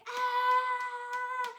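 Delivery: high, loud imitated cheer; long excited "Ah!"; cheering crowd scream. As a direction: shriek like friends cheering and clapping. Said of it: "start getting up and clapping, going".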